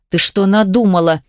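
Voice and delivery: ordinary delivery, nothing marked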